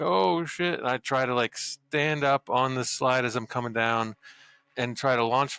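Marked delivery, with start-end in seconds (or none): 0.0-0.8 higher-pitched, alarmed exclamation; startled oh shit. Acted. Player voices his character spotting danger on the slide, startled. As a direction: act out a sudden wide-eyed realization. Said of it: "my eyes kinda get wide. I'm like".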